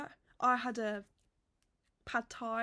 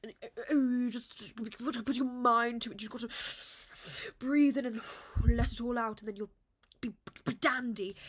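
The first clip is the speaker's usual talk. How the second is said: mocking the lady; 5 dB louder than usual. Mocking a pushy poetry lady's breathing advice, hammed-up. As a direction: parody the pushy workshop lady. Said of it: "this lady, she was like".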